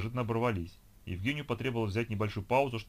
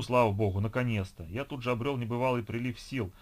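ordinary delivery

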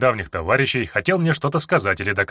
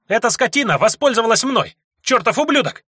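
разъярённый крик; 11 semitones higher, 4 dB louder than usual. Acted. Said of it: "разъярённо сверкая глазами".

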